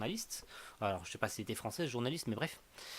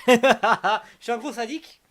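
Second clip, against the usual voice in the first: loud, high laugh into a confession; gleeful laughing; 8 semitones higher, 14 dB louder, slower than usual. Real, not acted. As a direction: laugh loudly at your own teasing. Laughs at own teasing of viewers.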